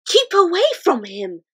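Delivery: pleading. A narrator's reading. Sophie begging the giant, pleading girl voice. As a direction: beg him to keep clear. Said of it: "Sophie pleaded".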